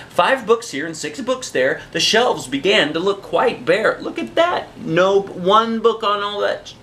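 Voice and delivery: ordinary delivery, nothing marked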